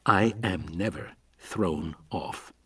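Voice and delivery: slow, soft and icy; said coldly. A narrator's reading. Levine correcting a colleague, slow and cold. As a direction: shut him down with cold calm. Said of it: "Levine said coldly".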